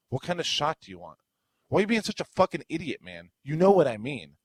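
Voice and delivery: high, fast, loud insult; angry rant. Retold or imitated. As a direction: fire it off in a rage. Retold angry bar customer.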